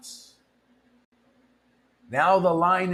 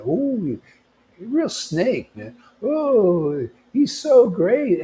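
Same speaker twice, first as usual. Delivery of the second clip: higher, sing-song gushing praise, clearly mocking; a sarcastic fan voice, raised in pitch and drawn out; mock adoring followers; 7 semitones higher, 3 dB louder, slower than usual. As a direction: imitate gullible devotees gushing over their guru. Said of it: "and, and everybody".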